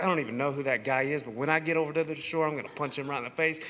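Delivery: low, fast, hushed grumble; grumbling aside. Acted. Peter privately griping about the stranger on shore, low grumble. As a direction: grumble a threat under the breath.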